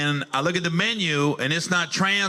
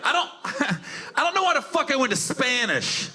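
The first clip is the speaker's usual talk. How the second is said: normal talking voice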